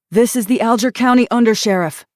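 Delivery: high, loud shout. Acted. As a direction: shout it from down the hall. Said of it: "voice shouted from the hallway".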